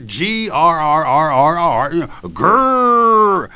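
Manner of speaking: slow, loud rolling dog growl; growl imitation. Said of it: "good representation of when we growl".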